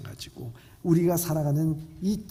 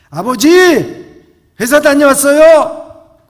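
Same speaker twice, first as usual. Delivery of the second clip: son calls out much louder, stretching the words; 12 semitones higher, 19 dB louder, slower than usual. Acted. Son calls to his father behind the newspaper, loudly and slowly. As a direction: raise your voice so a deaf father hears. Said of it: "더 큰 소리로 얘기합니다".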